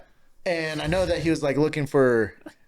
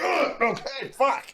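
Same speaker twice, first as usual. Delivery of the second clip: high squeaky bird caught out; squeaky surprised little bird; deflated bird voice; 14 semitones higher, slower than usual. Pretend. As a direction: play the cocky pigeon suddenly giving up, pitched high. Pigeon backing down after kick.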